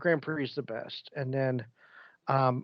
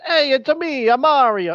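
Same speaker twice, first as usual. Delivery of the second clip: much higher, louder cartoon Italian plumber; Mario; 11 semitones higher, 6 dB louder than usual. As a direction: ham up the Mario catchphrase. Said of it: "It ain't like frickin' Mario Brothers".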